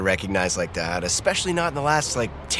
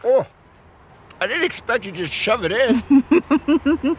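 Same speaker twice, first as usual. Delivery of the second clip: high, louder startled protest; 13 semitones higher, 4 dB louder than usual. Acted. Scene: Tony surprised when his date shoves the waffle in, startled. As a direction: caught off guard, the voice jumps up.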